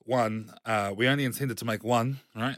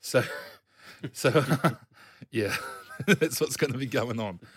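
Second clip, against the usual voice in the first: laughing; 2 semitones higher, 4 dB louder than usual. Real, not acted. Laughing at own bad accent.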